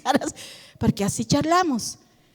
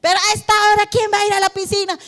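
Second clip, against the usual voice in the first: exasperated parent; 5 semitones higher, much faster than usual. Retold or imitated. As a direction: scold the late-night request. Parent refusing a late swim.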